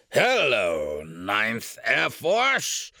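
put-on loud, drawn-out drunken hail; booming drunk officer greeting; drunk major. A narrator's reading. Drunken major hails captain.

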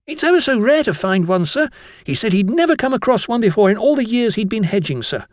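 put-on high-pitched boy explaining himself; eager schoolboy. Jennings explains himself to the master, high eager voice. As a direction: gush out your story to the teacher.

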